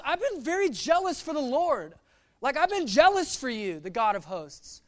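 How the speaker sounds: high, impassioned plea; protesting prophet. Acted. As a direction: plead your devotion loudly. Elijah's protest to God, acted out.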